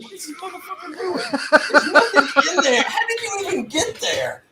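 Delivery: high, laughing shout of disbelief. Retold or imitated. Player baffled by a worker far off, laughing high-pitched disbelief. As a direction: crack up while demanding answers. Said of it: "I'm like".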